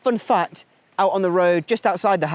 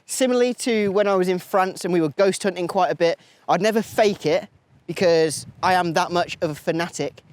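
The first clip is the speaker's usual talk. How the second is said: normal talking voice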